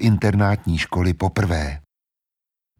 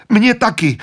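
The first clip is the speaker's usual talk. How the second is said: very high, louder fearful cry; frightened cry; 13 semitones higher, 6 dB louder than usual. Acted. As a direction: cry out in pain and fear. Lucy feeling the pull. (Lucie vyděšeně vykřikne)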